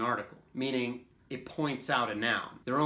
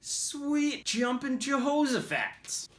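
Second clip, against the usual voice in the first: put-on old-timer exclamation; 14 semitones higher, 4 dB louder, slower than usual. Acting out interjection examples.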